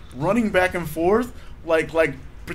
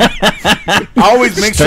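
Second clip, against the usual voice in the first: slow, louder, laughing through the words; giggling at the slip; 4 semitones higher, 7 dB louder, slower than usual. Real, not acted. Laughs at own double meaning.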